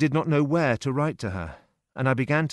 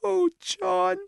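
high, tearful woman's voice; a sister crying her news; Annie weeping. A narrator's reading. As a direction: sister in tears telling her brother.

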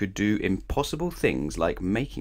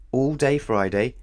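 ordinary delivery

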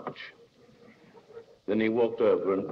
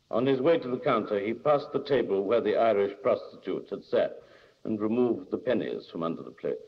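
ordinary delivery

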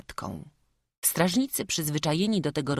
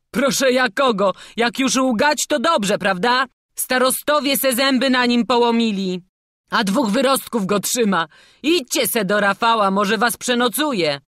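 high, loud mocking guard; loud derision; 8 semitones higher, 7 dB louder than usual. A narrator's reading. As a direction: scoff at their tall tale. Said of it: "Ceklarz rozśmiał się głośno". (głośna drwina)

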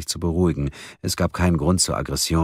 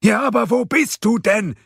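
very high, loud exasperated shout; yelling back; 5 dB louder than usual. A narrator's reading. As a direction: shout the question back at him. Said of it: "brüllte Ludwig zurück". (aufgebracht zurückbrüllen)

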